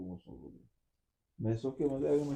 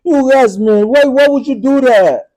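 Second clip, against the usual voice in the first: loud pleading; 11 semitones higher, 12 dB louder than usual. Real, not acted. Boyfriend telling his upset girlfriend it was a prank, loud and pleading. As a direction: insist it was only a prank.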